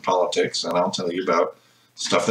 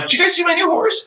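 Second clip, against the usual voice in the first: very high-pitched teasing imitation; mocking quote. Host teasing guest about her tweets, mocking quote. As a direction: mimic someone chirping about their news.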